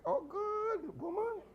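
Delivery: delighted exclamation. Retold or imitated. Delighted cry about foreign women.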